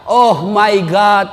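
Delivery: slow, drawn-out mock praying child; child's prayer, stretched out for comic effect; mock English prayer. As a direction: pray in English, hammed-up and slow. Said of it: "anak berdoa".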